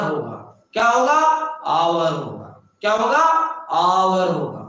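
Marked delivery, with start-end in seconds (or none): 1.7-2.1 "आवर" in a low, falling tone, no H; silent H. Shown as a demonstration. Teacher repeating the answer for students, "आवर" with silent H. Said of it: "H silent होता है". 3.7-4.3 "आवर" low and falling, vowel first; silent H. Shown as a demonstration. Teacher drilling the class on hour, "आवर" with silent H. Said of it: "H silent होता है".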